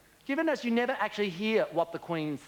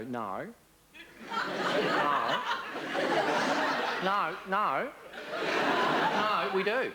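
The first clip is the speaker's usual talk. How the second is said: Aussie losing humour; much slower than usual. Retold or imitated. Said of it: "we go".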